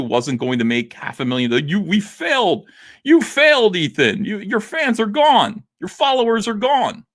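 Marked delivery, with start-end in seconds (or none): 1.7-7.0 high mock jeer; mocking taunt. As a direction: sneer it like a gloating hater. Mocking his critics' taunts.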